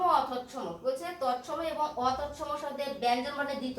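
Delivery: ordinary talk, nothing marked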